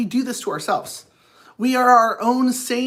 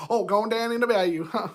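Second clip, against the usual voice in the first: ordinary talk, nothing marked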